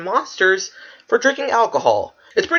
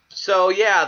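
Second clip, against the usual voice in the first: ordinary talk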